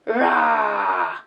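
throaty roar; short growling dinosaur roar. Acted. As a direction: roar like the scary T-Rex. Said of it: "Cały czas ryczy".

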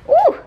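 very high, loud "ooh"; sudden squeaked ooh; high whoop. Real, not acted. Vlogger interrupted mid-sentence, high whoop.

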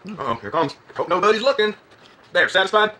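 exaggerated Southern American twang; put-on Southern accent. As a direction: tease in a hammy Southern accent. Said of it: "putting on a Southern accent".

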